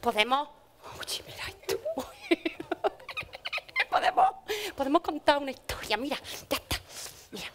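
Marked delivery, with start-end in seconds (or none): 1.0-1.8 hushed whisper. Acted. Paquita finds something. 2.3-3.8 high laugh. Acted. Paquita reacts to find.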